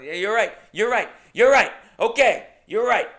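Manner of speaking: high, loud, repeated surrender; frantic conceding. Retold or imitated. Comedian backing down to livid woman in his face, frantic conceding.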